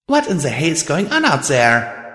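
high, startled exclamation; jolted awake, demand to know; startled alarm. Acted. Woken by explosion.

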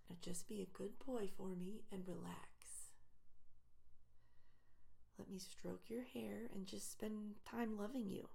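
soft tender whisper; soothing whisper. Acted. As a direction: whisper it close and lovingly.

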